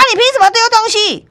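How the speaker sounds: high, fast imitated outrage; mock furious retort. Manager snapping back at the customer who threw things, mock outrage. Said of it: "店长就抓狂啊，反击讲".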